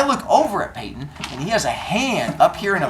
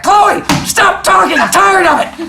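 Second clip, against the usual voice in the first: fed-up shout; 11 dB louder than usual. Retold or imitated. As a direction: bellow it like a fed-up teacher. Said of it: "And I'm like".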